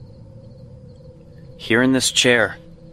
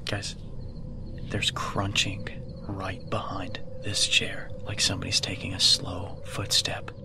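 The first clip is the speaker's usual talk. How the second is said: whisper; 4 semitones lower, 9 dB quieter than usual. Real, not acted. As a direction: whisper so nothing hears you. Hearing footsteps behind chair.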